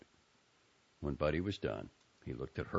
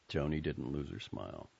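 ordinary delivery, nothing marked

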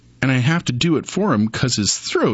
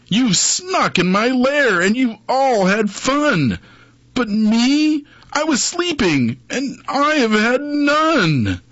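high, slow, whiny sulking bear; whimpering bear; 9 semitones higher, slower than usual. Acted. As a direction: whine it like a wounded bear. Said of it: "he whimpers and he moans".

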